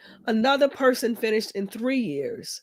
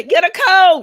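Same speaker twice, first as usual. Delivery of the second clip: shouted catchphrase; 7 semitones higher, 12 dB louder than usual. Real, not acted. Chanting their catchphrase together.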